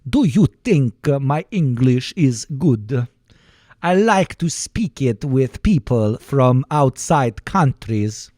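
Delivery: slow, exaggerated Swedish lilt; hammed-up Swedish singsong; Swedish accent. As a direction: bounce slowly through it in mock Swedish. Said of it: "My accent is getting better".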